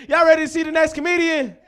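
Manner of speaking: high, loud hype call; crowd-rousing shout. Real, not acted. Host asking crowd about next comedian, rousing shout.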